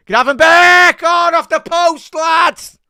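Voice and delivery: yelling high and loud at the near miss; excited fan yell. Real, not acted. Fan watching live, yelling at the near miss. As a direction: roar it as the shot hits the post.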